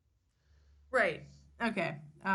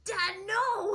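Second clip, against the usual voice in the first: much higher hammed-up cry of protest; mock pleading boy; 9 semitones higher, 4 dB louder than usual. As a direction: plead like a frightened kid, overdone. Acting beaten boy's plea.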